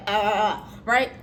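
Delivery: ordinary talk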